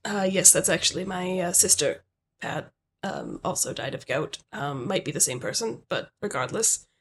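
low, soft manager voice; manager character. Acted. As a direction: play the manager low and understated. Plays the manager Pat.

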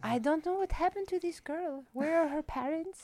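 put-on high, accented mom voice, softly fretting; soft-spoken, sweet mom. Comedian imitating her mom fretting over a girl, soft-spoken and sweet. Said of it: "soft-spoken and sweet".

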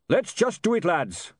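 high, eager rallying cry; high-pitched call to action; rallying yell. Acted. Dwarf completes his rallying yell.

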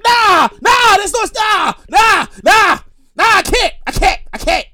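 high, loud, repeated bursts; loud, emphatic comic protest; shouted refusal. Real, not acted. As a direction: yell each refusal as a separate burst.

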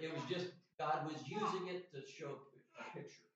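usual speaking voice

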